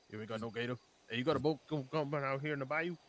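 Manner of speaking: put-on a loose Cajun accent, lightly done. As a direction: play a swamp man with a Cajun accent. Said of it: "stop doing the accent".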